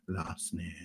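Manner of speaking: "Last name" softly said as French, "lahs nahm"; mock French pronunciation. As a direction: put a quiet French accent on "Last name". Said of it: "some great French pronunciation of it".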